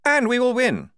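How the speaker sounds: high, loud, bluff officer's voice; booming jolly commander; hearty major. A narrator's reading. The major greeting the squad, loud and bluff.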